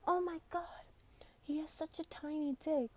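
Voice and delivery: high-pitched squeal, held back in volume; Kuznia's mock scream. Friend waking the sleeper, mock screaming. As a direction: imitate a shrieking friend without the volume. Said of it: "this bitch Kuznia is screaming".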